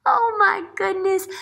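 high, loud oh my goodness; teacher's amazed exclaim; delighted surprise. Real, not acted. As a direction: gush to the class.

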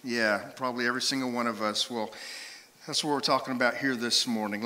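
ordinary delivery

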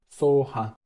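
ordinary talk

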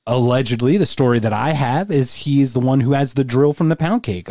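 ordinary delivery

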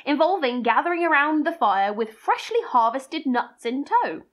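ordinary talk

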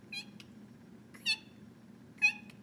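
creaky noises. Acted. Reader imitating creaks.

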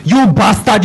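normal talking voice

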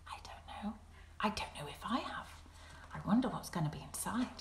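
hushed wondering whisper. Acted. Teacher wonders aloud to children, hushed whisper. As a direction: lean in and whisper the secret.

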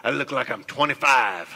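mock vain man. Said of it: "we look in the mirror and we go".

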